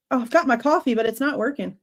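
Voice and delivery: normal talking voice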